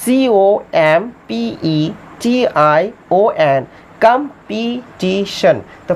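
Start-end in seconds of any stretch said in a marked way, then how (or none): 0.0-3.7 slow, letter-by-letter dictation; measured dictation of each letter; spelling out letters. Shown as a demonstration. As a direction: spell it slowly for the class. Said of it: "competition spelling".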